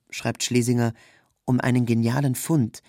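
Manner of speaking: plain reading voice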